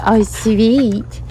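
ordinary talk, nothing marked

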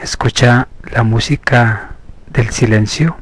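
slow, measured hypnosis voice with long pauses; guided meditation. Acted. Therapist leading listeners into hypnosis through slow breathing, measured meditation voice. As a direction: guide them inward, stretching the counts and key words.

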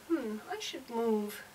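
slow, drowsy musing voice; cat's lazy thought. Pretend. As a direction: muse slowly like a sleepy cat. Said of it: "Maybe she's thinking".

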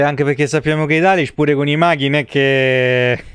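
normal talking voice

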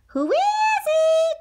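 high, slow quavering call; old granny calling. Acted. Granny calling through the door, high and slow. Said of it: "cried Granny".

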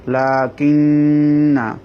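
slow "লাকিন্না" with long nn, short final na; silent-alif recitation. Shown as a demonstration. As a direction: recite "লাকিন্না" holding the humming n. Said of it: "আলিফটা পড়া আসবে না".

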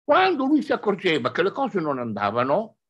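usual speaking voice

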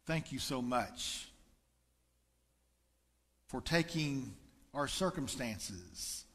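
earnest church prayer with slowed phrases; closing prayer. Acted. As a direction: pray gently over the congregation. Pastor leads closing prayer.

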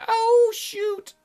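high squeaky "oh, shoot"; caught out. Retold or imitated. Princess caught by waking Zell, high squeak. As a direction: voice her panicking in a high squeak. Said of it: "She's like".

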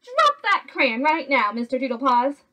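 mother laying down the law loudly; stern command. A narrator's reading. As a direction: order the child to stop, firmly. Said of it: "commanded Mama Junebug Jones".

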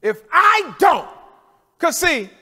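high, loud, slow shout; preaching shout. Real, not acted. Preacher shouting Job's challenge to the church, loud high cry. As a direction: cry it out to the congregation.

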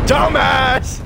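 high, loud yelled insult; high-voiced mocking shout; loud jeer. Jeering at an enemy.

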